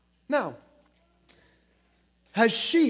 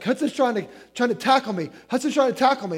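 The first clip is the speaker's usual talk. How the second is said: tattling daughter; 5 semitones higher, 4 dB louder, much faster than usual. Retold or imitated. Daughter runs in complaining.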